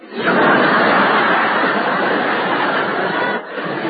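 breathy laugh; long laugh. Real, not acted. [name] laughs at the detour joke. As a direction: laugh hard.

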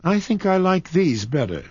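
put-on high, a touch wistful young girl; dreamy, tired of riches. A narrator's reading. Kismine holding the rhinestones, wistful. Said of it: "fingered the brilliants wistfully".

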